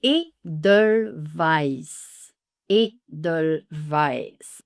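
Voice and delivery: "Edelweiss" very slowly with German "v"; slow German pronunciation. Shown as a demonstration. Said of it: "qui est un mot allemand".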